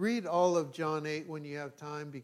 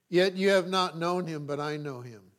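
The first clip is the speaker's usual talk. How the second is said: raised, declaiming Bible reading; scripture reading; 5 dB louder than usual. Acted. Pastor reading John aloud to the church, declaiming. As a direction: proclaim the text to the congregation.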